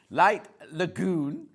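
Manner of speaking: Light Lagoon stretched out slowly, trying to get it right; slow careful retry. Shown as a demonstration. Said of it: "God, I can't even say it".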